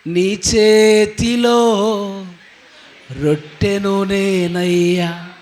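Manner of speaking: drawn-out pleading prayer, each phrase stretched; stretched, fervent prayer to Jesus; slow fervent prayer. Acted. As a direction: pray it slowly, leaning into every word.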